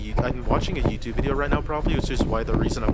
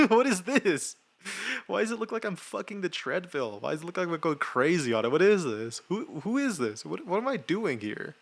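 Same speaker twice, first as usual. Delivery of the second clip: high-pitched, laughing disbelief; baffled questions squeezed through laughter; cracking up; 7 semitones higher than usual. Real, not acted. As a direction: laugh helplessly at your own footage. Cracking up at own running footage.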